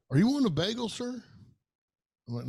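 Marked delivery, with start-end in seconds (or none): 0.1-1.2 put-on much higher, sweet old counter lady asking; little old lady. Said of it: "that little old lady, she goes".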